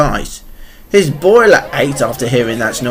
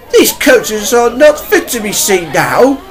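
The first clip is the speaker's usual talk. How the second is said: upset Duke; 8 semitones higher than usual. A narrator's reading.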